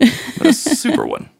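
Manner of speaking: squeaky high-pitched emphasis. Acted. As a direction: pitch it way up, cartoonish. Hamming up the dragon.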